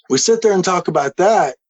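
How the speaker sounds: normal talking voice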